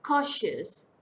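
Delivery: Cautious with a loud CAU, falling sharply; stress on first syllable. Shown as a demonstration. Teacher giving a two-syllable example, first syllable stressed. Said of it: "Stress falls on the first syllable".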